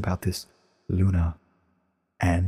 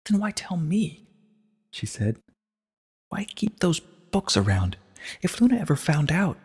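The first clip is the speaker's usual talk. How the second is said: barely controlled distress; 9 semitones higher than usual. A narrator's reading. Twilight confronts Celestia, high and barely composed. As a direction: keep control, just barely. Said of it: "attempting to keep control of herself".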